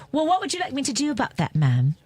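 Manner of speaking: high-pitched British flight attendant; imitating a London flight attendant's British accent. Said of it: "Your accent is great".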